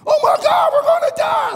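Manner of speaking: high, loud panicked scream; panicked yelling. Retold or imitated. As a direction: scream it like a terrified passenger.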